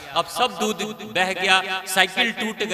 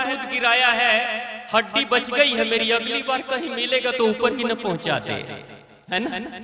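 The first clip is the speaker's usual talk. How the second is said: hammed-up weeping milkman; 6 semitones higher than usual. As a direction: wail it like a scared milkman, half laughing. Milkman's punchline lament.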